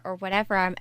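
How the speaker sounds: ordinary talk, nothing marked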